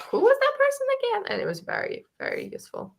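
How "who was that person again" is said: high, puzzled self-quote asking who someone was; mock-confused, squeaky high pitch as if lost in the names; confused question. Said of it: "a couple times to be like".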